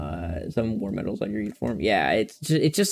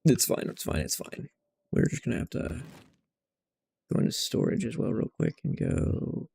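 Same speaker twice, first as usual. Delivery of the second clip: very soft, half-whispered self-talk; hushed, absent-minded mumbling; muttering to self; 8 dB quieter than usual. Real, not acted. Muttering to himself while searching.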